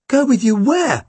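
high-pitched, indignant question; incredulous. A narrator's reading. Boy answers policeman's order, incredulous.

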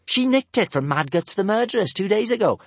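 put-on girl explaining the theft. A narrator's reading. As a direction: explain proudly. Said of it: "explained Kamikaze".

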